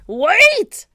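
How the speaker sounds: high, loud holler; desperate cry. A narrator's reading. Captain crying out as his men board the boat. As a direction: call out after a departing boat. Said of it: "cried Cap'n Bones".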